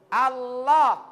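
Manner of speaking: Allah with the tongue kept in; correct Allah. Shown as a demonstration. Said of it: "Jangan tempel di gigi". (Allah dengan lidah tidak keluar)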